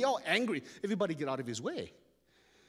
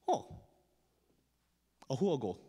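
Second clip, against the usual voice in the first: ordinary delivery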